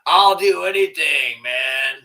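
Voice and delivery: mock him as desperate to please; mocking Joe Rogan. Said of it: "He's like".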